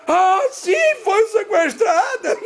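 high, singsong mock wail; mocking echo. Mother answering daughter's kidnapping story, mocking high-pitched echo. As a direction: throw the excuse back in an exaggerated whine. Mother mocks the sister's excuse.

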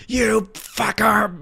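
hammed-up outraged lady screaming at the kid; mimicking the woman yelling at him in the church lot; furious yell.

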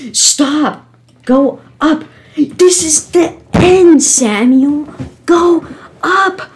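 high, loud frustrated commands; real outburst of game rage; exasperated shouting. Real, not acted. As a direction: scold yourself at the top of your pitch. Scolding self after repeated falls.

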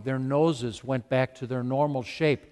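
ordinary talk, nothing marked

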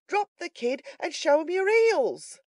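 high, loud, drawn-out call; cried out. A narrator's reading. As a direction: yell urgent advice before fleeing. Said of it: "cried Toby".

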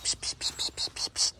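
rapid voiceless hissed psst sounds; short repeated hisses to coax an animal; luring psst. Real, not acted. (lockende Zischlaute)

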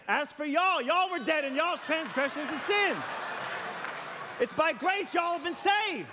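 scripture read with a drawled Southern y'all. Shown as a demonstration. Pastor rereads scripture the Southern way, hammed up and laughing. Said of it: "we really should be saying".

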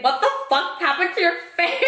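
high, shocked disbelief; shocked outburst. Retold or imitated. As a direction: blurt it out in shock. Friend shocked by her lips.

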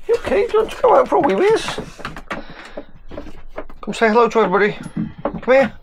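high, loud doggy-talk voice. Real, not acted. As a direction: coo up high and bright at the pup. Greeting the dog Max.

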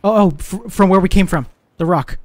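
high, loud startled callout; alarmed shout. Real, not acted. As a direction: yell the warning fast. Alarm at shot from behind.

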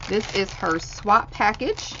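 usual speaking voice

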